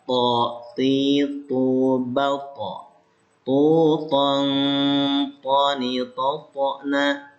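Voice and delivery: crisp drill syllables To, ti, tu, each one clear and separate; articulation drill. Shown as a demonstration. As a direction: model the syllables for pupils to copy. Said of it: "Coba ikutin, dengerin dulu ya". (latihan makhraj, suku kata jelas)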